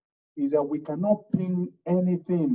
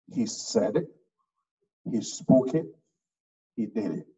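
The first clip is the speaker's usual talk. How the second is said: he said it, he spoke it, he did it, with a pause after each; deliberate pauses; 7 semitones lower than usual. Shown as a demonstration. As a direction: space out each phrase for emphasis. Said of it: "That's intentional pause".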